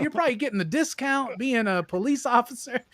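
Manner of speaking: high, laughing sass, like a prostitute brushing off a cop; mock sassy scolding. Imitating the prostitute telling the cop to calm down, high and laughing. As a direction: tease him as the worldly-wise woman.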